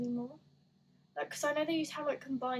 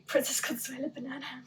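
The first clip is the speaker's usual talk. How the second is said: a laughing American accent; 4 dB louder than usual. Real, not acted. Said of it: "Why did I say that with an American accent?".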